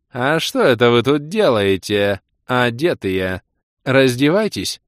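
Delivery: slow, teasing seductress lilt; lightly voiced demoness, coaxing; playful demoness. A narrator's reading. Demoness urges the party to undress, playfully seductive.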